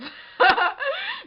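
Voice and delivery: high, loud burst of laughter. Real, not acted. Laughing at her own threat to sock him, a loud laugh. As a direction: crack up loudly.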